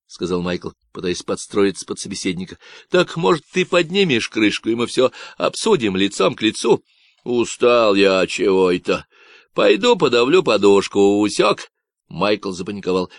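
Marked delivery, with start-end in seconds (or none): 2.9-11.7 loud, nasal Texan ranting about ecologists; a cocky stranger sounding off; Davy's Texan voice. Acted. Stranger on the radio ranting about ecologists, nasal Texan.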